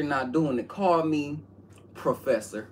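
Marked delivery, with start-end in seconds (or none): none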